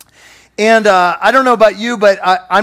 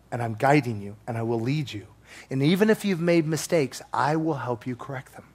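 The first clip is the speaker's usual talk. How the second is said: hushed, calm reassuring voice of Jesus; gentle reassurance; 6 semitones lower, 5 dB quieter than usual. Retold or imitated. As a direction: soothe him, quiet and steady.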